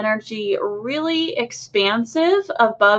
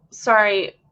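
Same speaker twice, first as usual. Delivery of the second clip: ordinary talk, nothing marked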